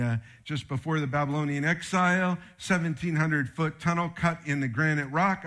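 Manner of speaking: ordinary delivery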